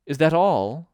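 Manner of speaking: loud, high, indignant question; swallowed anger. A narrator's reading. Alice to the caterpillar, swallowing down her anger. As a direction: ask with anger only just held in. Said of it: "swallowing down her anger".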